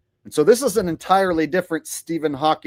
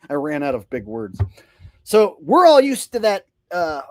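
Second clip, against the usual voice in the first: usual speaking voice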